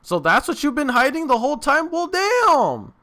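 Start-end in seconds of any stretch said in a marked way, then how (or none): none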